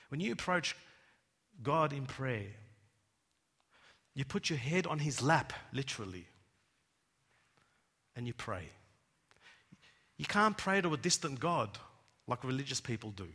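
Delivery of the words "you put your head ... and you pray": ordinary talk, nothing marked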